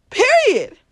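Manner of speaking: high, loud final word; loud period; emphatic. Real, not acted. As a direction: slam the point shut. Emphatic closer.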